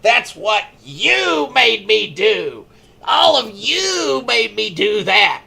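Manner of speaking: slow, high, drawn-out mock killer voice; creepy confession. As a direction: stretch it out like a proud madwoman. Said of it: "she's like".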